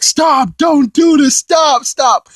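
very high, loud pleading yells; frantic shouting. Retold or imitated. King Kai begging Goku to stop, high frantic shouts. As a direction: scream at him to stop. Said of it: "King Kai is like".

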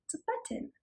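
put-on secret whispered reveal to the children; breathy hush as if sharing a secret. Teacher whispering a surprise to little listeners.

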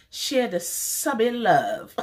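high, slow sing-song; sing-song slogan. Real, not acted. She sings her slogan to viewers, high and slow. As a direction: draw out the slogan like a little tune.

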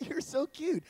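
high, sugary coo; put-on adoring high voice; fake sweetness. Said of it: "and I was like".

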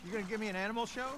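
excited. Real, not acted. Teasing friend loudly.

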